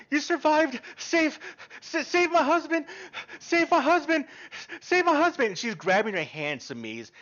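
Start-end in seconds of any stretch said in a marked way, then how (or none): 0.1-5.5 desperate woman crying out, high-pitched; hysterical pleading. Acted. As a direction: beg frantically at the top of your voice. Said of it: "And she is hysterical".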